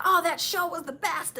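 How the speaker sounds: high-pitched excited quote, gushing over a show; retold gushing.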